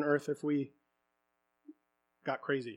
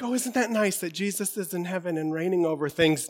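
mild complacent musing. Acted. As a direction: toss it off mildly, as a complacent churchgoer. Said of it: "We often be like".

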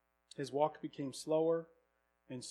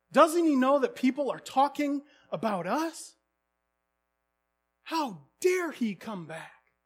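higher, louder, slow furious questions; livid rant; 8 semitones higher, 7 dB louder, slower than usual. Retold or imitated. Middle son raging about his returning brother, livid rant. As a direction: spit out each outraged question. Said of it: "was livid".

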